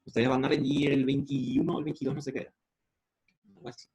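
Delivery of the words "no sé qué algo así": ordinary delivery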